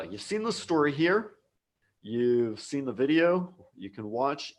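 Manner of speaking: ordinary talk, nothing marked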